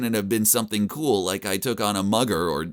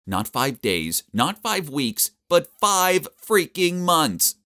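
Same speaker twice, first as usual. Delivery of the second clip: each phrase higher, slowing for "five freaking months"; escalating emphasis; 6 semitones higher, 3 dB louder, slower than usual. Acted. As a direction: build from "days" up to a high, loud "five freaking months". Escalating disbelief at coma length.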